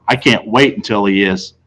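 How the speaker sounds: ordinary delivery, nothing marked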